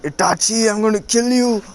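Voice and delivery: high, loud mock-furious shout; a staged angry threat at his brother; fake angry yell. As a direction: yell it like you're pretending to be mad. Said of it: "pretending to be angry".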